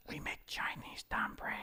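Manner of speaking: put-on soft whispered Chinese accent, high; Ting Ting ASMR whisper. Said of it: "She goes".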